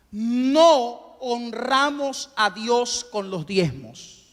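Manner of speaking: measured, drawn-out repeat; slow and deliberate. Shown as a demonstration. Preacher repeating the point for the congregation, slowly and deliberately. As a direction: say it at half speed, lingering on NO. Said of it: "se lo voy a decir despacito".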